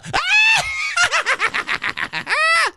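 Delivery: very high, long cackling laugh; high cackle. Real, not acted. Host told he sounds like Crypt Keeper, high cackle. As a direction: cackle away in a high, stuttering run.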